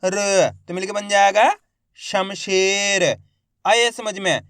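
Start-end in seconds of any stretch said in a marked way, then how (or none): 2.0-3.0 Shamsher drawn out slowly as one word; slow blended reading. Shown as a demonstration. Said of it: "देखो किस तरीके से". (शमशेर को धीरे धीरे जोड़कर)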